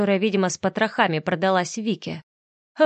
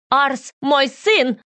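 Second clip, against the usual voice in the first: slow, higher, louder shriek; hysterical cry; 7 semitones higher, 5 dB louder, much slower than usual. A narrator's reading. Вика cries out hysterically claiming her son. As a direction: hysterical outburst. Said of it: "истерично вскрикнула она".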